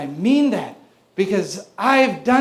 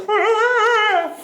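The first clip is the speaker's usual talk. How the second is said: put-on baby babble; 10 semitones higher than usual. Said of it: "He's just like".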